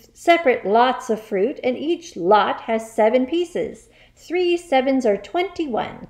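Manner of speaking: loud, high, hectoring rant; angry shout. Acted. Headmistress drills and insults a pupil, shouting. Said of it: "shouted the Trunchbull".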